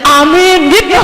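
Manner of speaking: loud eager amen; a hopeful amen for the children. Shown as a demonstration. Preacher mimicking parents hoping only for their children: eager amen. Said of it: "Kayak aminnya tuh gini ya".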